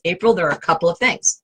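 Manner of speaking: usual speaking voice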